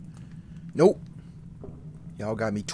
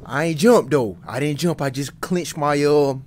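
loud denial; 8 dB louder than usual. Real, not acted. Denying he got startled.

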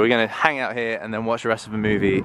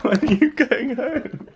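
teary voice; 12 semitones higher, slower than usual. Real, not acted. Tearful surprise.

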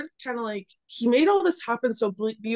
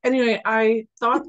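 usual speaking voice

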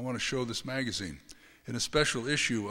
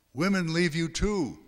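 louder, higher retort within narration; raised-voice quoted retort; speaking aloud; 6 semitones higher, 5 dB louder than usual. A narrator's reading. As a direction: voice the line out loud, lifted above the narration. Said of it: "spoke aloud".

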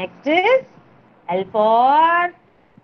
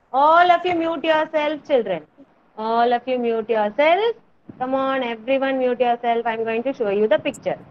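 ordinary delivery

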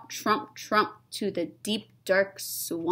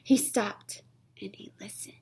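spooky hushed whisper; breathy storytime whisper; 4 dB quieter than usual. Acted. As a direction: whisper it like a secret in the dark. Narrator hushes the suspense.

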